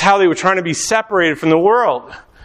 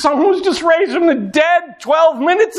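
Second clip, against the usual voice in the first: excited; 10 semitones higher than usual. Acted. Disciples excited about a miracle.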